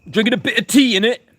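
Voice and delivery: loud, very high-pitched English parody; mock English caricature. Streamer mocking the English over tea, high loud caricature. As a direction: squeal it as a silly tea-drinking Englishman. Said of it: "If you're English".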